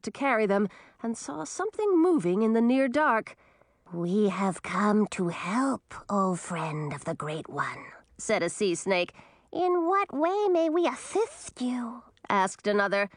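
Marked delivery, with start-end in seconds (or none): 3.9-7.8 slow, whispered snake voice; hissing sea snake. Acted. 9.5-12.0 high, slow serpent voice; polite serpent; sea snake voice. Acted.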